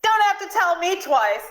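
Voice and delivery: put-on high shout missing the British accent; botched British accent. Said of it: "that's not a British accent".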